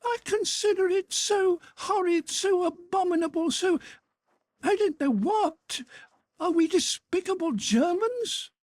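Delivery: put-on angry young girl nearly shouting at her brother. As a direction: burst out in outrage. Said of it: "Natasha suddenly almost shouted".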